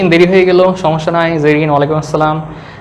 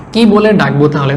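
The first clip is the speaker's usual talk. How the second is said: ordinary delivery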